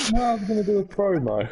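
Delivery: excited. Real, not acted. Announces a wrestling promo.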